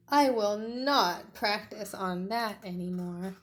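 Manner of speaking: loud, slow and firm; Tiny resolving. Acted. Tiny after the cactus spikes, firm. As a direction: swear off the cactus.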